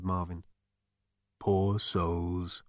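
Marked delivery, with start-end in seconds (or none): 1.4-2.6 disembodied ghostly voice, low-key; deep, ethereal voice. A narrator's reading. Voice in Zaphod's ear, deep and ethereal. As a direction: speak as a bodiless spirit. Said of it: "said a deep, ethereal voice".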